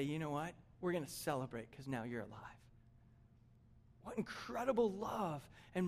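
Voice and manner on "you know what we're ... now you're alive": low and very soft; hushed forgiveness. Acted. Father forgiving the kid who rejected him, hushed and tender. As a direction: tenderly drop the voice right down.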